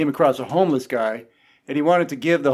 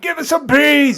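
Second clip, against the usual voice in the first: very high, loud mob cry; mock demanding shout; 13 semitones higher, 6 dB louder, slower than usual. Crowd outside demanding peas, mock demanding shout. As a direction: yell it high like a clamouring crowd.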